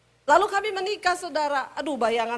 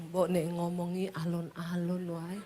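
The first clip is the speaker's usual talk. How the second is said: lower, much softer Javanese; soft low Solo husband; 6 semitones lower, 8 dB quieter than usual. Retold or imitated. Husband asking wife to speak gently, soft low voice. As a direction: keep it low and soft, tone sinking. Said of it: "Orang Solo kalau ngomong nadanya turun terus".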